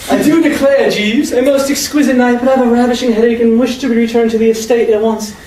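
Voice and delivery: hammed-up uppity English lady; snooty English accent. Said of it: "apes my snooty accent".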